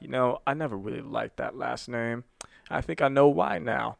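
slow, soft quoted confession; imitating a subdued, measured film monologue; quiet monologue. Joker speaking to his mom in hospital, imitated quiet slow delivery.

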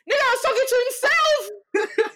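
shouting the punchline, half laughing; incredulous shout. Real, not acted. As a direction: yell it in disbelief. Outraged punchline about liar.

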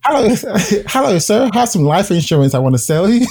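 high-pitched, put-on polite sales voice. Shown as a demonstration. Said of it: "I'll do the other voice".

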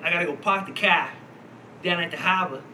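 imitated Boston accent, R's dropped. Showing how someone from Boston talks, imitated Boston accent. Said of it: "around somebody from Boston".

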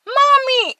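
high, frightened calls through the house; a small child searching room to room, calling out; child calling for mom. Retold or imitated. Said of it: "I'd be like".

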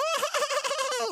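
very high cartoon laugh; put-on squeaky rapid giggle; Pops's giggle. Running over for a hug, very high imitated giggle. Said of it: "Pops go run to him and go".

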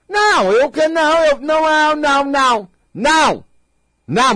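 high, loud string of refusals; hammed-up childish protest; mock tantrum. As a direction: whine no over and over like a spoiled child. Imitates a childish person refusing.

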